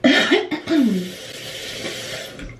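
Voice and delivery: harsh hacking cough; coughing fit. Real, not acted. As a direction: cough hard, chesty and rough. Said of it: "Excuse my hacking".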